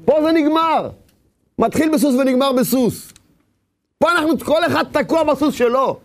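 high, impassioned sermon voice; fervent preaching. Acted. Preacher at full force.

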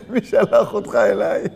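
drawn-out mock suspicion cracking into laughter; laughing retort. Annoyed man to nosy questioner, laughing and slow.

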